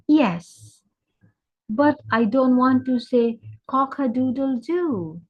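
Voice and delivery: lightly voiced chicken, high and emphatic; objecting chicken. Chicken tells the cow it won't crow, objecting.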